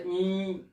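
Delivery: इं sounded alone, a nasal hum; isolated nasal. Shown as a demonstration. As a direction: pull out the bare sound इं. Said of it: "तो ये जो आवाज आ रही है". (अकेली ध्वनि इं)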